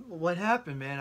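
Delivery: high, loud startled inner cry; shocked. Retold or imitated. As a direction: gasp it out in disbelief. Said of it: "and I'm thinking".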